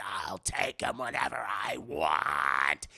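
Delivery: deep, slow demonic taunt. Retold or imitated. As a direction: stretch it out low and menacing like a demon. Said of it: "He's like".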